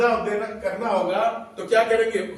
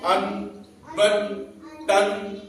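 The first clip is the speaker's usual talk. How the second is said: very slow تن، سن، with each syllable held; slow sounding out; much slower than usual. Shown as a demonstration. Teacher showing children how the nasal sound comes out, slow. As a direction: sound out تن، سن، slowly. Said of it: "اس طرح آواز آ رہی ہے۔".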